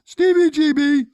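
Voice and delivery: loud, high, booming name call; announcer shout. Acted. Sidekick voice shouts the host's name to hand back. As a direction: call out the host like a ring announcer.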